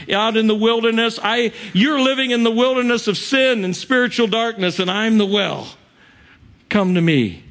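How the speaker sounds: high-pitched, urgent pulpit proclamation; soaring sermon delivery; impassioned preaching. Real, not acted. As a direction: preach it with full conviction. Preaches at full force.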